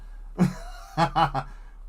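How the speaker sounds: airy, high mischievous laugh; breathy cackle. Real, not acted. Streamer laughing at his own plan, airy snicker. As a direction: snicker at your own prank.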